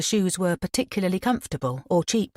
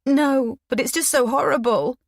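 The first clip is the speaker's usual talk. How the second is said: put-on higher, distressed young woman; nearly in tears; upset; 8 semitones higher, 3 dB louder than usual. Upset girl replies.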